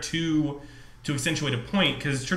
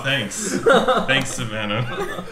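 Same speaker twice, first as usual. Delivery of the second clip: usual speaking voice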